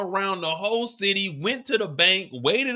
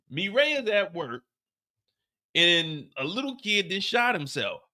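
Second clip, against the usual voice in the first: ordinary talk, nothing marked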